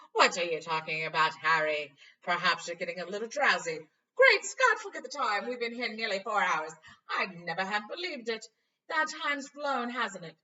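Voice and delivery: loud, cheerful, oblivious; breezy Lockhart. Acted. Vain teacher dismissing Harry, loud and breezy. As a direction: chatter on brightly.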